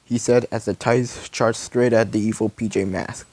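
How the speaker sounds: ordinary delivery, nothing marked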